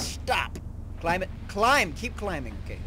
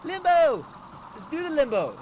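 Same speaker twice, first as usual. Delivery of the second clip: excited cheering; 10 semitones higher than usual. Retold or imitated. Imitating himself cheering about the game Limbo, high and excited. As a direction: sing it out like a party chant. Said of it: "So I was like".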